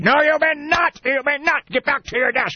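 high-pitched, scolding caricature of a mean boss; Ebenezer Scrooge. Acted. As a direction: refuse the clerk sharply in a put-on voice. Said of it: "Mister Scrooge".

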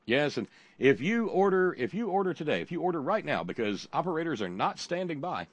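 high, eager order-now pitch; excited infomercial pitch. Pretend. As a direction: push the order-now offer hard.

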